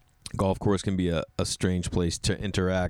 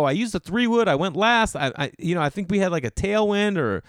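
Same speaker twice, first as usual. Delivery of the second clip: high, loud rushed excuses; flustered excuses; 8 semitones higher, 3 dB louder than usual. Retold or imitated. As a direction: babble the excuses defensively. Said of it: "I go".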